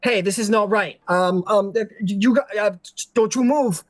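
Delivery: flustered and scared, tripping over words; nervous Iron Fae. Acted. Iron Fae freaking out at the prince, high stammering fright. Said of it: "and it goes".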